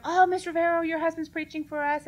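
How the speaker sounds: high, loud, gushing greeting; eager church greeter. Retold or imitated. Church member excitedly greeting the visiting pastor's wife, high and gushing. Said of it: "they'll be like".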